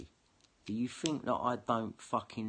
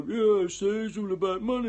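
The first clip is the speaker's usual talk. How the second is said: high, louder sneering mock troll voice; 10 semitones higher, 7 dB louder than usual. Imitating the trolls for his viewers, high mocking sneer. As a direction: jeer like a smug online troll. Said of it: "the fucking trolls are just gonna go".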